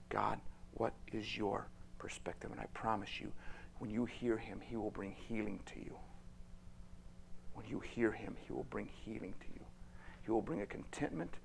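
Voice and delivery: soft hushed earnest whisper; quiet intimate sermon close; whispered promise. Real, not acted. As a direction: draw them close and whisper it.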